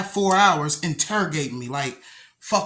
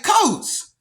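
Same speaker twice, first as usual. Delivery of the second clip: calling back; 13 semitones higher than usual. Retold or imitated. Sister calls back his name.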